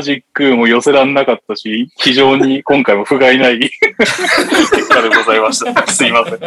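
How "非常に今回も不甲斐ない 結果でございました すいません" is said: words broken by laughter; fighting back giggles; laughing. Real, not acted. Laughs through closing line.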